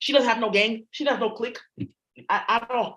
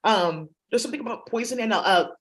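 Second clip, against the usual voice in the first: ordinary delivery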